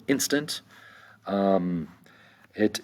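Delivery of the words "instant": "instant" with IN higher and stressed; stress on first syllable. Shown as a demonstration. Teacher showing the accent, "instant" stressed on its first syllable. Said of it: "accent is over the first syllable".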